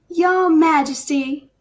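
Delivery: slow, high, syrupy Southern drawl on the greeting; a Southern lady greeting the king with a big sweet drawl; young noblewoman in a Southern accent greeting the king; hyped Southern belle. Acted. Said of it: "I was wondering if I'd do Southern again".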